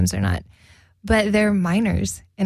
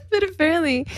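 laughing; 10 semitones higher, faster than usual. Real, not acted. Cracking up over slang terms.

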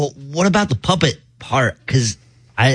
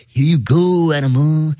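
ordinary delivery

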